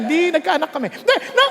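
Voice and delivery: normal talking voice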